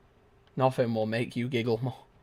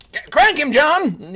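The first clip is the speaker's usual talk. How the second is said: high, loud hype yells; excited shouting; 9 dB louder, slower than usual. Real, not acted. Gamer yelling at teammate John to snipe. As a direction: cheer your teammate on.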